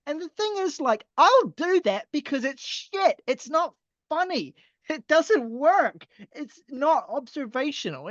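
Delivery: high-pitched frustrated ranting; exasperated rant. Real, not acted. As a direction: vent your frustration, pitch climbing. Exasperated rant about lyrics.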